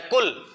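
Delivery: कुल with a quick, short u; one fast beat on कुल; brief demonstration कुल; short उ, quickly. Shown as a demonstration. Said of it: "ऊ का sound जल्दी से आता है".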